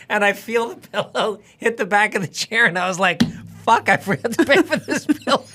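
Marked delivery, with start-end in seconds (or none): 3.6-5.4 laughing realization. Retold or imitated. Realizes he stole the pillow.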